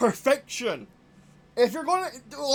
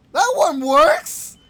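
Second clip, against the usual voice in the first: loud, slow exclamation of disbelief; frustrated yelling; 4 semitones higher, 9 dB louder, slower than usual. Real, not acted. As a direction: cry out higher and louder, exasperated. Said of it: "Sorry that I'm yelling".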